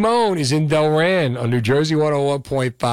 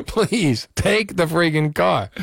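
higher-pitched, laughing plea; joking plea; 7 semitones higher, slower than usual. Acted. Host begs would-be thieves to take the old car, joking plea. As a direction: beg with a laugh, half-exasperated.

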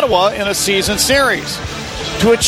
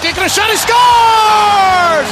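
drawn-out high shouted goal call; 12 semitones higher, 5 dB louder, much slower than usual. Real, not acted. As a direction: belt out the goal slowly.

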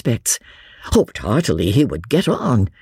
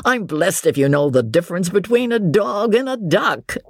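amused; 6 semitones higher than usual. A narrator's reading. James teasing his unsporting cousin, amused. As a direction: tease as if amused by your own absurdity. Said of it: "laughing at his own absurdity".

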